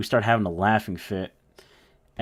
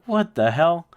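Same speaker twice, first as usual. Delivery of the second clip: higher, louder what the hell; stunned disbelief; 9 semitones higher, 7 dB louder, slower than usual. Retold or imitated. Friends watching the sheriff speed past, stunned outburst. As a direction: blurt it out in shock.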